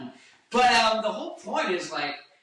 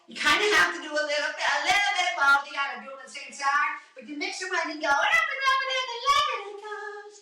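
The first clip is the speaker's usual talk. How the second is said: higher mixed voice, faster; 13 semitones higher than usual. Shown as a demonstration. Singer demos mixed voice while explaining. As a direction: blend speech and falsetto. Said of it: "do something in the middle".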